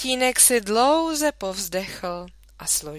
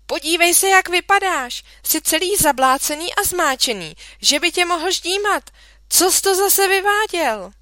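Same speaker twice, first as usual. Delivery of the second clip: high, loud scolding mother; mother yelling at her muddy son; scolding shout; 10 semitones higher, 8 dB louder than usual. A narrator's reading. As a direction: tell him off at the top of your voice. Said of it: "dala se do křiku".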